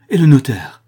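startled fearful outburst; dread. A narrator's reading. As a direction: blurt it out in sudden alarm. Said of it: "Puis le lapidaire avec effroi".

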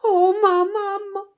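high, loud panicked cry for mother; terrified cry. A narrator's reading. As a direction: scream for mom in terror. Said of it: "cria Ratapon".

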